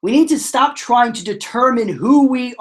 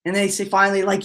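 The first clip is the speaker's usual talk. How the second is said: ordinary delivery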